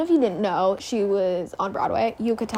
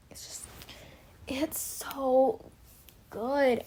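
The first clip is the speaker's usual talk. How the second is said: breathless whisper of awe; awed whisper; 3 semitones higher, 6 dB quieter, much slower than usual. Real, not acted. Reviewer whispering her awe at the album. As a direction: hush it like it's too good to say aloud.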